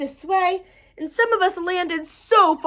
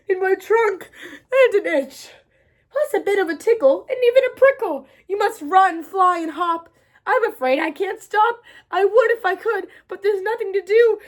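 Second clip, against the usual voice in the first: building up to a sneeze; 5 semitones higher, 4 dB louder than usual. Acted. Elephant about to sneeze.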